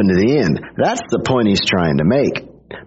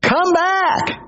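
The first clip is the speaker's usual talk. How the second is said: high call after departing crowd; much slower than usual. Retold or imitated. Jesus calling after disciples leaving him, high pleading call. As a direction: call them back as they walk off.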